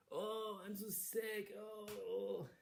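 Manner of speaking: high, moaning, hammed-up invalid; fake sickness. Queen faking sickness to the king, high moaning. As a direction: overplay being ill to fool him. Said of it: "pretended to be sick".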